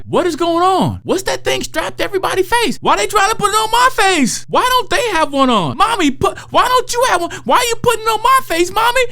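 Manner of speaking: put-on high, rising panicky child voice; frightened little kid. Toddler on a plane begging mommy to take the mask off, panicky child voice. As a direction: play a scared toddler pleading with mommy. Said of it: "They might be thinking things like".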